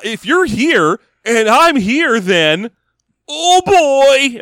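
put-on slow build to a high "oh, boy"; cheesy sitcom punchline, climbing in pitch; hammed-up sitcom realization. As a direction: draw out the dawning mock dismay. Continuing sitcom gag before freeze frame.

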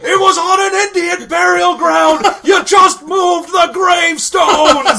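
high, loud, hammed-up wailing; mock anguished shout. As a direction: yell it in overblown horror-movie outrage. Parodies a frantic Poltergeist outburst.

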